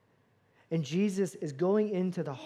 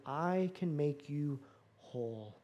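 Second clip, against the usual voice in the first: tender promise; 3 semitones lower, 7 dB quieter, slower than usual. Acted. Jesus promising the woman.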